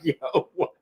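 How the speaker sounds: laughing so hard the words fade out; cracking up. Retold or imitated. Reacting to fans' complaints, cracking up. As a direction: barely get it out through laughter. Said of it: "yo, I be crying".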